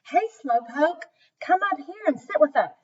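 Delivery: high call across the tent. Acted. Brother and sister calling across the tent, high. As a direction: wave her up to the seats. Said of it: "Jack and Debbie called to her".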